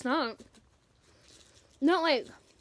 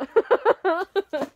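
laughing; 9 semitones higher, 12 dB louder than usual. Real, not acted. Laughing at tasting.

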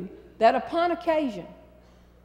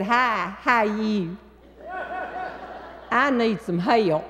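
a light, sweetened Southern drawl, only lightly put on; put-on Southern accent; slower than usual. To the toll booth man, working a light Southern accent. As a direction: lay the Southern charm on, lightly. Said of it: "This would be a good time to pull out my Southern accent".

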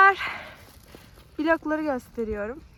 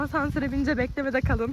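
ordinary delivery

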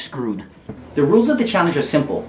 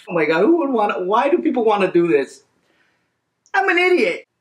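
higher-pitched wail of self-reproach; exasperated regret; 6 semitones higher than usual. Real, not acted. Burning challenger berating themself for trying, exasperated. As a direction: lament it in real spicy agony.

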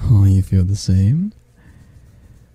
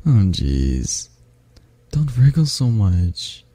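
ordinary talk, nothing marked

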